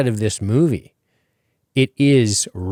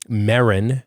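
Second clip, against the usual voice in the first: usual speaking voice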